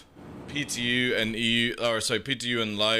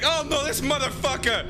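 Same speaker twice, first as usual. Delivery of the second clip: mock outrage; 12 semitones higher than usual. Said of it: "And you're like".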